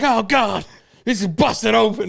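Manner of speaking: loud, high mock-horrified ringside call; wrestling commentator. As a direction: call the bloody match in hammed-up alarm. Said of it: "like, when wrestlers".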